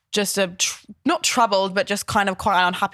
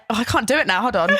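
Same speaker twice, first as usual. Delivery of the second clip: normal talking voice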